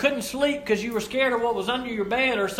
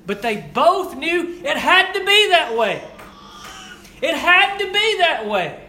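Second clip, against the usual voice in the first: emphatic preaching; 5 semitones higher, 5 dB louder than usual. Real, not acted. Emphatic sermon climax.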